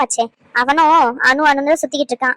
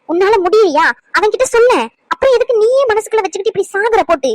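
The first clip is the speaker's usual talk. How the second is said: higher-pitched, heated pleading outburst; exasperated urging; 5 semitones higher than usual. Acted. Visitor urges Anu to tell him her problem, exasperated. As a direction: push them in exasperation to speak up.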